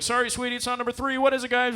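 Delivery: high-pitched announcer patter; game host. Retold or imitated. Stadium host correcting a little girl, high presenter voice. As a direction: break it to her, then rally the crowd.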